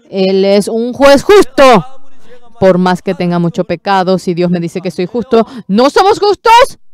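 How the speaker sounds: loud, high preaching climax; a preacher convincing the pastors, loud and high; fervent preaching. Acted. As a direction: proclaim it with full force.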